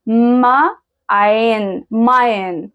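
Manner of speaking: "ما", "عين", then blended "ماين"; sounded-out word. Shown as a demonstration. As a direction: build "ماين" slowly from its sounds. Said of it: "يبقى أخدنا النطق أهو".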